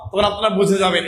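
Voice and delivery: ordinary talk, nothing marked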